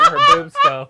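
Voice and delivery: high squeal through laughter; laughing. Real, not acted.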